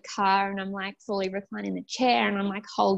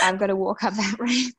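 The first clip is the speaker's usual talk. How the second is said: laughing; 3 dB louder than usual. Real, not acted. Laughing at the ramp.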